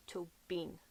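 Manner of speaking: Tobin as toe-BEEN; correct Tóibín. Shown as a demonstration. Explaining the fadas to viewers, Tobin as toe-BEEN. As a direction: say Tobin softly with long EE ending. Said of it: "both on the I".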